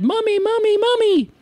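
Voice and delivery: put-on high child's shout calling her mommy; excited child. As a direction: call out to mom like a thrilled kid. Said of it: "ran over and said".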